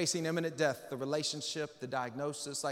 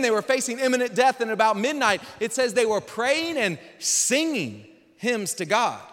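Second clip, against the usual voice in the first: ordinary delivery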